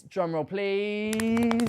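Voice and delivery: slow, stretched drumroll please; drawn-out announcement. Acted. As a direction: stretch it out for suspense. Building suspense before winner reveal.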